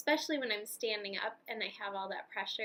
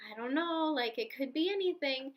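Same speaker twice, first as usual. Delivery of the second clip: higher-pitched imitation of an unsure doctor; 4 semitones higher, 3 dB louder than usual. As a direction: mimic someone with no firm answer, pitched up. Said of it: "he was more confident, whereas my doctor is like".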